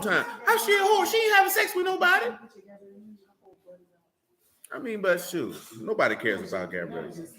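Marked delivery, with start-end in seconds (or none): 0.4-2.2 mock high voice. Mocking someone's claim.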